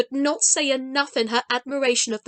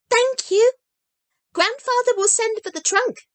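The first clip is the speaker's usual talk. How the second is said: hasty; 10 semitones higher, 4 dB louder than usual. A narrator's reading. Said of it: "she said hastily".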